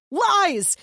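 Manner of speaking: sharp high shout. A narrator's reading. Deluded brother shouting down the narrator. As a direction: fling the word out loud and high. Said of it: "he shouted at me".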